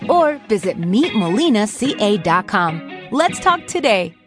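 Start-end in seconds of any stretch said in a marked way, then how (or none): none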